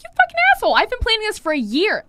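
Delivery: high, loud mock outrage; acted angry retort. Stylist answering client who spoiled her planned vacation, mock outraged. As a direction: fire back in exasperated, hammed-up indignation.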